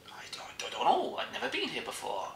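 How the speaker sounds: soft, high, bad French accent; whispery mock French tourist. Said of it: "That's a French accent".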